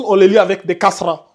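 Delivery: ordinary talk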